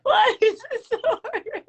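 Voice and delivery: high, loud, laughing it out; can't hold the giggles in. Real, not acted. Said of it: "I can't stop laughing".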